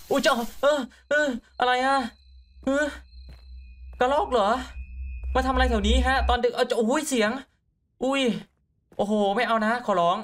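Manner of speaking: jumpy voice begging no jump scare; startled. Real, not acted. Player startled by a squirrel at night, squeaky and scared.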